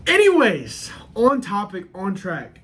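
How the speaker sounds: high, loud drawn anyways; sing-song topic switch. Real, not acted. Vlogger drags himself back on topic, high sing-song.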